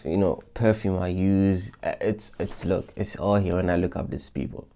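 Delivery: ordinary delivery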